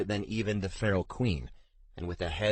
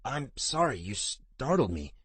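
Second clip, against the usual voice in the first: startled apology; 5 semitones higher than usual. A narrator's reading. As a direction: get the words out after a fright. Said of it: "he managed".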